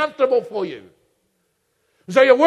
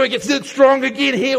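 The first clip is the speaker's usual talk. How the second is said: usual speaking voice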